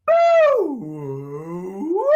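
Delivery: warm-up boo. Shown as a demonstration. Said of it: "go like this".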